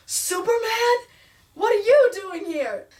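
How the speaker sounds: put-on a kid gasping at a superhero; mock kid amazement at Superman; surprised kid. Kid Ben sees Superman enter.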